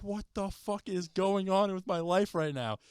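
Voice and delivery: high, slow, disbelieving cry while spinning; bewildered. Retold or imitated. Spun in circles during free fall.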